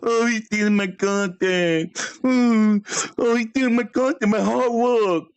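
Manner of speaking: high, mock-whiny voice of the crybaby rival; mocking whiny imitation. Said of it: "You're gonna be like".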